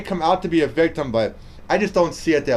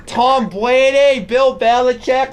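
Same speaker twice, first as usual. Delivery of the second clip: higher, slow hammed-up Boston accent; bad Boston accent; 8 semitones higher, 3 dB louder, slower than usual. Host listing Patriots names in a hammed-up Boston accent. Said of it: "now that was my bad Boston accent".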